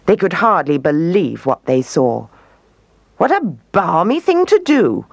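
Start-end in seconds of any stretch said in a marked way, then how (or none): 3.2-5.1 amazed koala. A narrator's reading.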